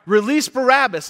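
imitating a crowd calling out; retold crowd cry. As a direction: call it out like a crowd. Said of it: "And they cry".

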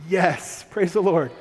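loud, jubilant yes; relieved cheer. Retold or imitated. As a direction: cheer like the family just got rescued. Family cheering real spaghetti.

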